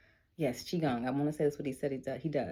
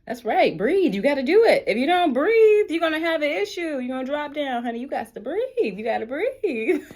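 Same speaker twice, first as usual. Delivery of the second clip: high, loud sassy coach; sassy self-coaching; 10 semitones higher, 12 dB louder than usual. Retold or imitated. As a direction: scold yourself like a diva coach. Coaching herself to breathe.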